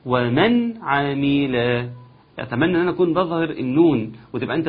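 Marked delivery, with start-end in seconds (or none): 0.1-1.6 ومن عمل slowly, the nun fully sounded; clear nun. Shown as a demonstration. Teacher showing izhar, slow. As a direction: make the nun in ومن audible. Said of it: "اسمع كده".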